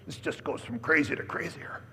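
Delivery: a whispered scoffing aside, voice dropped to breath; mock whisper. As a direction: whisper it as the guests gossiping. Whispered aside mocking guests.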